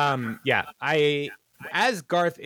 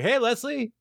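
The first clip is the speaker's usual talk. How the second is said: put-on old dude greeting; 10 semitones higher than usual. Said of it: "He's like".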